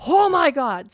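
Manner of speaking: stunned gasp. Retold or imitated. To herself, realizing the neighbors could always see in, aghast. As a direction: let the shock burst out. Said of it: "And I was like".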